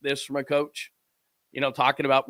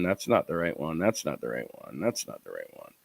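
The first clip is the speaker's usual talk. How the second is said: trailing lower and softer; frustrated muttering; 8 semitones lower, 6 dB quieter than usual. Real, not acted. Host hunting for the right clip, muttering in frustration. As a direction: mutter it to yourself, sinking lower each time. Frustrated searching, repeating himself as it fades.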